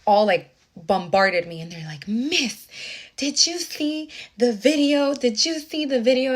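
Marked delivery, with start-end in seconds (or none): 2.1-6.3 imitating students pressing their teacher for news; excited students. Teacher imitates students rushing her, high and eager.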